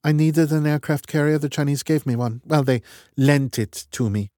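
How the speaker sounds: clipped and matter-of-fact, in charge; brisk woman's voice. Acted. Voices Strat, brisk leader.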